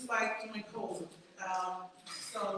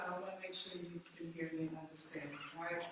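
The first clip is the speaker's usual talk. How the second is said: normal talking voice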